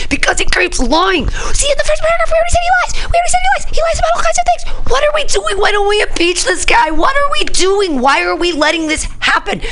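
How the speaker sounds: very high, loud frantic rant; exasperated outburst. Real, not acted. Host losing it over a lying president, exasperated shouting.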